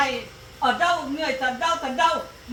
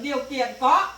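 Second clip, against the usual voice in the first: ordinary talk, nothing marked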